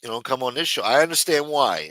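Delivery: ordinary delivery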